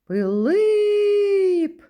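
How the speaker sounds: high, loud call with a long second И; drawn-out name call, stressed on the last syllable. Shown as a demonstration. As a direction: call "Пилип" as if to someone far away. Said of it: "Давайте покличемо".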